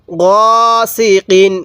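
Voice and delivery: গসিকিন read very slowly, syllable by syllable; slow recitation. Shown as a demonstration. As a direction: draw out গসিকিন carefully. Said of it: "কিভাবে পড়বেন".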